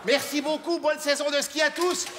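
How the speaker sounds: high, loud goodbye to the crowd; shouted farewell. Real, not acted. Comedian shouting goodbye to the Liège audience, loud and high. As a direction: call it out over the applause.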